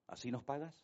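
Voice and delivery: whispered reproach, barely voiced; softly whispered grievance; hurt whisper. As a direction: breathe it like a wounded complaint. Sister reproaches the Lord.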